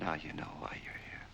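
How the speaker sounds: soft, cold whisper; menacing whisper. Acted. Captor to prisoner, cold whisper. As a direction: hiss it quietly as a final threat.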